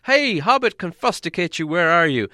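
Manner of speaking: high, loud calling into the dark; shouting and calling. A narrator's reading. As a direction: call out for the lost hobbit. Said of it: "They shouted and called".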